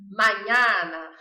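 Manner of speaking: slow, loud hammed-up Italian mañana; mañana with a hammy Italian flourish; mock Italian. Shown as a demonstration. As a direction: ham it up like an Italian soap star. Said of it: "encarnar Terra Nostra e falar italiano".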